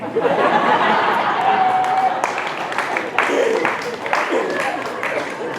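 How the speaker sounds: high, loud burst of laughter; long, loud helpless laugh. Real, not acted. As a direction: let a big real laugh out. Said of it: "This is making me laugh".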